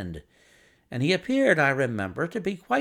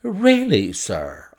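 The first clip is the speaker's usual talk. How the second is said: high, loud protest dropping sharply; affronted exclamation; indignant cry; 4 semitones higher, 8 dB louder, much slower than usual. Acted. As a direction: cry out, taken aback. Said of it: "I cried".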